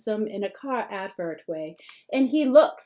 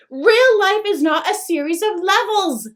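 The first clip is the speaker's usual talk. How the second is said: much higher, louder exasperated outburst; mother losing patience; flipping out; 9 semitones higher, 9 dB louder than usual. A narrator's reading. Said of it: "Mom flips".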